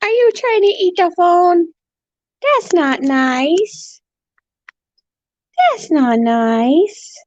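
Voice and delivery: high, very slow mock scolding; drawn-out sing-song telling off. Real, not acted. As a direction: scold the bird sweetly and slowly. Gently scolding bird.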